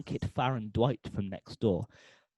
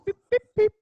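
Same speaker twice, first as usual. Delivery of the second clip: high, quick electronic beeps; imitated app beeps; 9 dB louder, much faster than usual. Presenter mimes setting the app's temperature, high imitated beeps. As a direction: beep like buttons being pressed.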